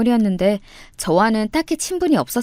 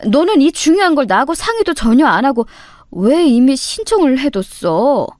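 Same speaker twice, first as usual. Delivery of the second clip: agitated, higher questioning of a son; worried reproach; 4 semitones higher, 4 dB louder than usual. Acted. Mother grilling her son over delaying graduation, agitated reproach. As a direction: press him with rising, fussing disbelief.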